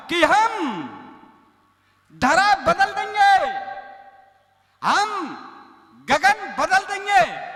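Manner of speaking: high, slow, booming heroic declamation; heroic poetry roar. Retold or imitated. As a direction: thunder out each line like a war poet. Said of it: "ये वीर रस की कविता इन्होंने पढ़ना शुरू किया". (वीर रस में ऊँची, धीमी, गरजती आवाज़)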